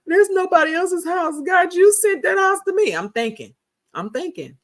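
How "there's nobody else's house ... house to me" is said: high, hammed-up tearful wail; mock crying, pleading up high; crying retold. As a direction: plead to God in a put-on sobbing voice. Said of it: "I was crying".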